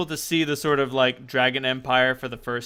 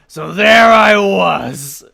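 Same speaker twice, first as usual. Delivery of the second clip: loud, slow, drawn-out proclamation; hammed-up bard opening; grand storyteller opening; 6 semitones higher, 15 dB louder, much slower than usual. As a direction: announce it like an epic tale. Ghost begins his tale grandly.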